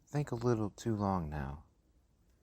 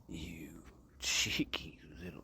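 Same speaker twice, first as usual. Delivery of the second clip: angry whisper; 5 semitones lower, 8 dB quieter than usual. Acted. Scolding in hushed tones.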